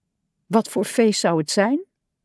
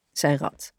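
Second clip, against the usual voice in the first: plain reading voice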